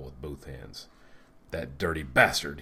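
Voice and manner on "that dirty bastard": loud outburst, leaning on the curse; narrated angry shout. A narrator's reading. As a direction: shout it out in anguish. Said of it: "he shouted".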